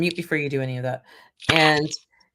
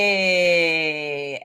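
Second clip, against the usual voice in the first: ordinary talk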